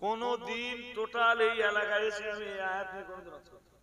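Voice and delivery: ordinary delivery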